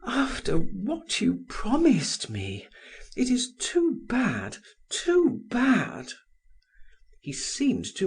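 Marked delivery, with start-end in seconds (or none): 0.0-6.1 stammering. A narrator's reading. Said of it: "She stammered".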